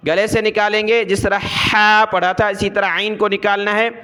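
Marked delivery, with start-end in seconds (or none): none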